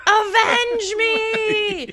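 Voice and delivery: high, slow, hammed-up scream; screamed dying plea. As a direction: bellow it like a dramatic death scene. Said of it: "he screams".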